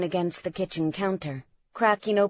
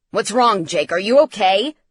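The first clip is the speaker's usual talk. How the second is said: loud, urgent questioning; worried father's raised voice; alarmed concern; 7 dB louder than usual. A narrator's reading. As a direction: ask urgently if he's safe. Father alarmed at son's panic.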